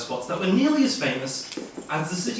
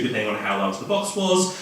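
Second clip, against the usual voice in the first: plain reading voice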